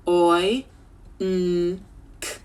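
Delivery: segmented Oi, n, k; stretched sounds. Shown as a demonstration. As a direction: sound out oink piece by piece. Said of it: "Let's stretch it".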